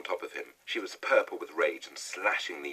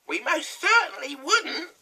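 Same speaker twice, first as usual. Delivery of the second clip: much higher, louder witch cry; crying out as hag; 13 dB louder than usual. A narrator's reading. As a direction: call it up like a gleeful old hag. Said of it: "cried Missus Twit".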